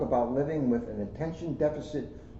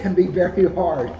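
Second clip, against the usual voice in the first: louder and higher, hammed-up emphasis; loud emphatic repeat; 6 semitones higher, 9 dB louder than usual. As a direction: punch it out for a laugh. Finishes the joking repeat.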